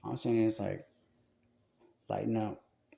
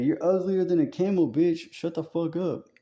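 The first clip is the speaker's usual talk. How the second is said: loud, high tough-guy shout at a heckler; hammed-up angry street comeback; mock gangster yell; 6 semitones higher, 5 dB louder than usual. Jesus voiced yelling at Pharisee.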